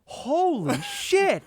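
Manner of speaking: high, loud, amazed gushing relative; cheek pinching aunt. Retold or imitated. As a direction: marvel at the kid like an old uncle. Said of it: "I was that fucking guy where I'm going".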